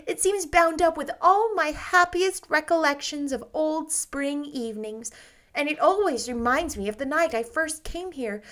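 put-on bright, breathless girlish rapture; Anne's voice. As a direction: gush like a dreamy, happy girl. Anne voiced, girlish, higher.

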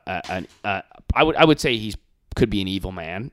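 ordinary delivery

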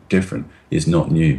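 ordinary talk, nothing marked

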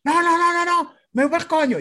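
very high mock protest; imitated yelling woman. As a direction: fire off her refusal in a shrieky high pitch. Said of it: "que ella me está gritando". (negativa chillona de mujer, imitada)

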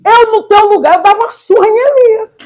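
high, loud objection; indignant protest. Retold or imitated. Preacher telling God to thrash Elias, indignant.

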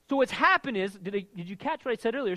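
ordinary delivery

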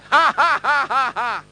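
loud booming laugh; God laughing. Retold or imitated. Preacher acting out God laughing at the congregation, booming laugh. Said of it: "He's laughing, like".

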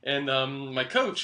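ordinary talk, nothing marked

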